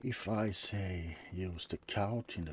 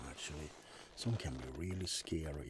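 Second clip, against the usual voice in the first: ordinary delivery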